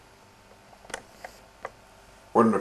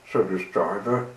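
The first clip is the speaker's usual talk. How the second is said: plain reading voice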